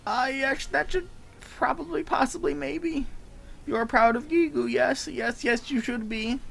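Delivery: high, eager little goblin voice; voiced NPC.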